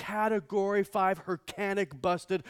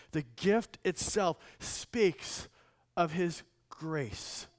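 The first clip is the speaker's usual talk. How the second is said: ordinary talk, nothing marked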